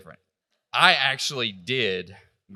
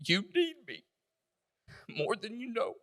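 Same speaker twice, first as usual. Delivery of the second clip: voice catching; 10 semitones higher, 4 dB quieter than usual. Real, not acted. Inner voice heard at sunrise.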